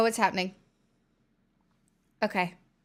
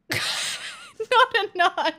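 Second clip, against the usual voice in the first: laughing; 11 semitones higher, much slower than usual. Real, not acted. Laughing at donation message.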